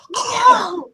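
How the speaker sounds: high sudden gasp of surprise; sharp gasp. Acted. Mayor biting the cream pastry, high gasp.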